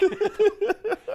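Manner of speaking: loud high cackle; high laugh. Real, not acted. Laughing at retold joke.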